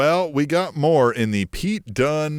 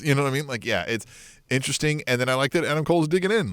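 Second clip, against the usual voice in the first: ordinary delivery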